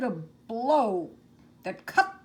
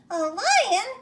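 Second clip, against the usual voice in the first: much higher, louder squeaky shriek of surprise; alarmed cry; 11 semitones higher, 6 dB louder than usual. Acted. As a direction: mouse queen startled by the word lion. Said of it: "cried the little queen".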